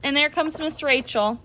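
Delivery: plain reading voice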